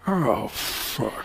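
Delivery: low, muttered dread; Al Pacino. Retold or imitated. Star spotting his rival at the elevator, muttered impression. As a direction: mutter it with weary dismay. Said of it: "the Pacino looked and went".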